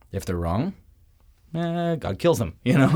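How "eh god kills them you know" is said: wry joking aside. Real, not acted. Joking aside about false prophets.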